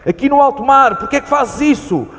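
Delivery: high, loud panicked pleas; high frantic calls for help at sea; frightened pleading. Acted. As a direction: beg him to sit down, terrified of the waves. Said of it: "tenho medo".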